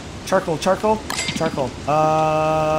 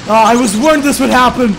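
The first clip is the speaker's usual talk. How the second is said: loud, high panicked no's; panicked yelling; 7 semitones higher, 5 dB louder than usual. Real, not acted. Gamer watching his character catch fire, panicked shouting. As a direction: shout in alarm as it goes wrong.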